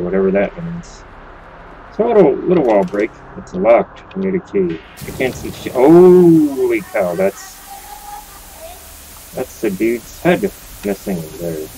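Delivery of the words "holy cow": long drawn-out, loud exclamation; high, slow burst of surprise; astonished. Real, not acted. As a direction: stretch it out in real amazement. Startled by sight in game.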